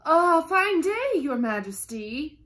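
ordinary delivery

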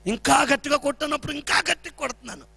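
ordinary talk

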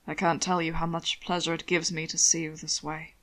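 a hint of a low, fond husband's voice; lightly voiced pleased husband. Hannibal delighted to find his husband in his bed, low and fond.

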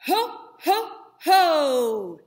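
loud, punchy ho ho ho from the belly; belly-driven loud ho ho ho; diaphragm ho ho ho. Shown as a demonstration. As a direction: pump out three loud ho's from the diaphragm. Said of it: "make your diaphragm jump".

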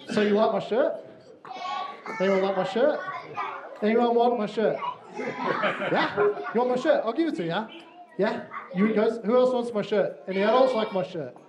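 playful teasing. Real, not acted. Pastor teasing the children about his shirt, playful and laughing.

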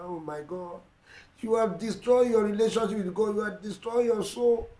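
usual speaking voice

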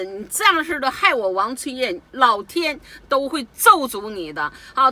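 loud, high, furious protest; furious retold shouting. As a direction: yell it at the embassy woman in outrage. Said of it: "我一听我就怒火上来了". (愤怒地大声斥责使馆的人)

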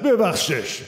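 acting out God's insistent cry; retold shout. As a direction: call it out high, as an order. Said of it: "به همین بلندی تو قلبم فریاد زد".

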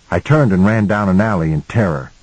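ordinary delivery

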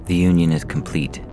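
usual speaking voice

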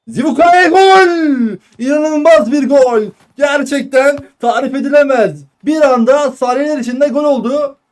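ecstatic goal scream, high and loud; screaming football commentator. Real, not acted. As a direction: roar the goal call. Goal call in live commentary. (bağıran futbol spikeri)

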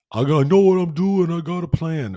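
high, loud dopey boxer voice; mock Rocky voice. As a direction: ham up a dim-witted boxer. Rocky voiced, mocking, far.

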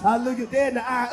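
ordinary delivery

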